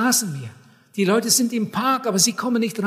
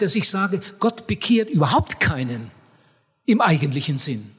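normal talking voice

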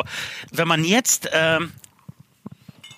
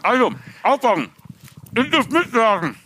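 mouth full. Acted. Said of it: "ich muss das Lied aber mit vollem Mund singen".

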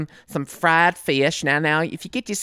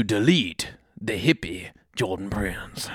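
whisper; 7 semitones lower, 5 dB quieter than usual. Acted. Plotting against rival host.

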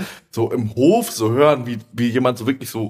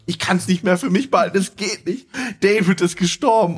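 agitated blurting; 8 semitones higher than usual. Retold or imitated. Woman blurts out the news.

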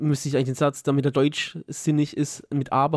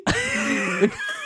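sehr hohes, amüsiertes Loslachen; hoch auflachen; echtes hohes Lachen. Real, not acted. Lacht über das erinnerte Video. (helles echtes Gelächter)

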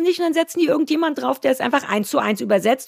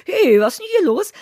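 cheeky Little Red; 8 semitones higher, 4 dB louder than usual. Retold or imitated. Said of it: "die war auch so eine kleine".

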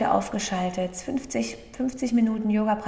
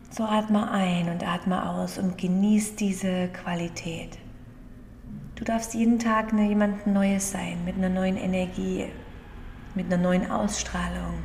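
guided meditation. Acted. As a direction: gently guide the breathing, unhurried. (ruhige Meditationsstimme)

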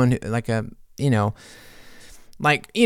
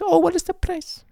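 squeaky high mock gasp of surprise. Cake in the face, squealing mock surprise. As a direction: act falsely delighted.

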